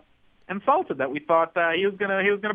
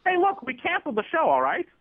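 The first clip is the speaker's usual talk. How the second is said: higher-pitched defensive protest; defensive yelling; 8 semitones higher than usual. Acted. Producer defending his cancelled show to the interviewer, defensive. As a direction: snap back defensively, pitch jumping up. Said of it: "Don't yell at me".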